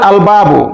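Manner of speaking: "আল বাবু" with the article's lam audible; lam pronounced. Shown as a demonstration. Teacher showing students the lam sounded in "আল বাবু". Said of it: "আলিফ লামের লাম".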